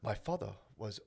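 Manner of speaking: hesitant African-immigrant English accent. Shown as a demonstration. Comic tries his one impression, African accent. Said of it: "It's an African immigrant speaking English".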